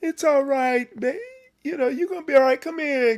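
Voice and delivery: high-pitched coddling mama voice; coddling mother. Acted. Mother comforting a boy who fell, high coddling voice. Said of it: "a woman's gonna be like".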